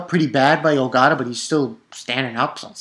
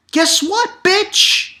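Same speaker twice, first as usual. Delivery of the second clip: high mocking taunt; gleeful taunt; much slower than usual. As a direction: rub it in, higher and cocky. Taunting hype.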